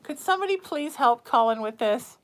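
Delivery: ordinary talk, nothing marked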